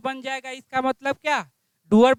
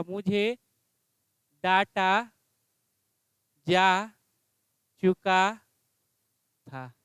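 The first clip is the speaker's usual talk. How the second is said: slow dictation; 4 semitones lower, slower than usual. Shown as a demonstration. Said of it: "क्या हो जाएगा?".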